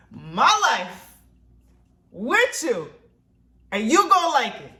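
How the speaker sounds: loud, high, punched-out phrases; playfully insistent shout. Real, not acted. Vlogger telling viewers she is sharing her life, insistent shout. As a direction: declare it in emphatic, separate bursts. Mock-defiant declaration to viewers.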